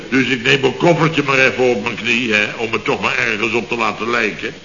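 ordinary talk, nothing marked

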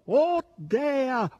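high, loud boar's howl. A narrator's reading. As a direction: howl it in adoration. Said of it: "ululò questi". (ululato acuto e forte)

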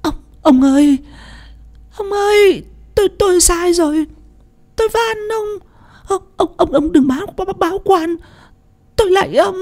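very high, wailing plea; begging in tears. A narrator's reading. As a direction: sob and beg him not to report her. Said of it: "bả van xin". (giọng khóc lóc van xin thảm thiết)

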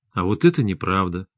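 low, soft, steady denial; quiet, composed husband; calm. A narrator's reading. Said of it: "спокойно сказал Максим".

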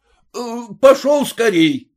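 high, urgent call to the driver; shouted order. A narrator's reading. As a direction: shout to get him moving. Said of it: "крикнул мужику с подбитым глазом".

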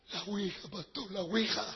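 hushed, low confession; soft girl's reply. Retold or imitated. Freed girl confesses to her pastor, soft hushed voice. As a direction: barely voice it, softly. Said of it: "con voz suave".

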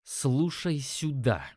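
СЛУШАЙ СЮДА slow, each word separately stressed; each word chiselled. A narrator's reading. Narrator warning the dealer, "Слушай сюда" slowly chiselled out. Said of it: "чеканя каждое слово".

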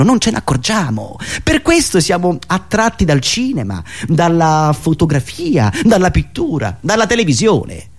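ordinary delivery

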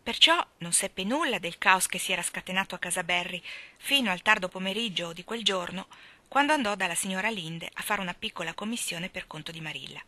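ordinary delivery, nothing marked

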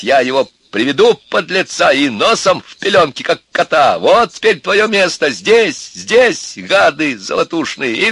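high, loud angry tirade; threatening rant. A narrator's reading. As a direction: spell out the threat furiously. Father ranting threats about the seducer. (гневная громкая угроза отца)

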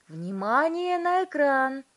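high, loud, drawn-out call; shouting. A narrator's reading. Wonka directing the parents to the screen, shouting. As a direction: shout for everyone's attention. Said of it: "крикнул мистер Вонка".

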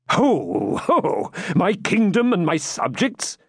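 high, scoffing royal retort; impatient king with a mock ho-ho. A narrator's reading. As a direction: brush the warning off impatiently. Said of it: "answered Richard impatiently".

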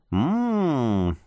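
long soft hum, savoring the smell; drawn-out hum. Acted. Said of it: "протянула Люсенька".

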